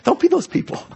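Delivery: Don't be those people, sung up high and teasing; squeaky, teasing repeat; high playful warning. Real, not acted. Preacher teasing the congregation, high playful voice. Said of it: "repeated higher".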